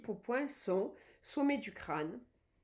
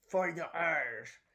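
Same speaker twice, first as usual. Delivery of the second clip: rage jouée; 3 semitones lower, slower than usual. Shown as a demonstration. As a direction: rage surjouée, lente et appuyée. Joue la rage en démonstration. (fausse fureur, mots traînés)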